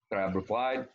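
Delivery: ordinary delivery, nothing marked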